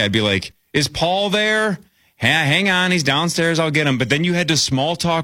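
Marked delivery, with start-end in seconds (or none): 0.7-4.0 higher, slow motherly phone voice; a mom answering the phone; friend's mom voice. Retold or imitated. Kid voicing friend's mom on phone.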